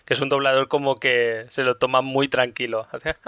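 normal talking voice

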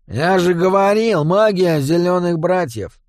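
high, slow smug goblin; smirking goblin. A narrator's reading. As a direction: gloat with a grin. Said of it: "ухмылялся малыш Ларс".

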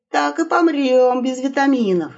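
usual speaking voice

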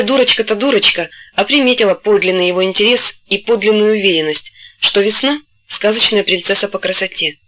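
ordinary delivery, nothing marked

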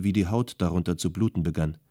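ordinary delivery, nothing marked

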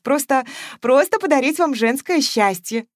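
usual speaking voice

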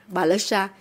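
usual speaking voice

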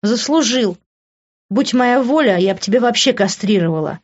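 high-pitched woman's spiteful retort; angry, unforgiving voice; embittered. A narrator's reading. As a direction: answer him with open spite. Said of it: "озлобленно сказала Лив".

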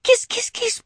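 high, happy cat call; boy calling his cat, overjoyed. A narrator's reading. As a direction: call the kitty with joy. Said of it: "позвал я кота".

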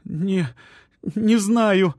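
sobbing reply. A narrator's reading. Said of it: "всхлипнула она".

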